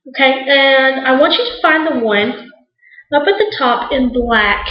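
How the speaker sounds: usual speaking voice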